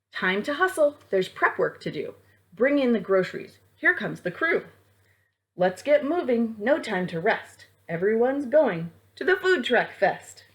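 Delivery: lively rhyming storybook read; reading to children. A narrator's reading. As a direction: bounce through the rhymes for kids. Reads picture book aloud.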